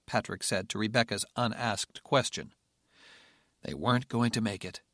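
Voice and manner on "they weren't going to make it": usual speaking voice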